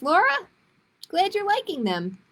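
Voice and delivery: ordinary delivery, nothing marked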